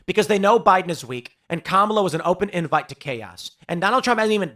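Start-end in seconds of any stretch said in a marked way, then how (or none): none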